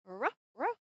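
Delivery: playful barking ruff ruff; dog bark imitation. Shown as a demonstration. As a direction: bark like a friendly dog for the child. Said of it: "What is the sound of the dog?".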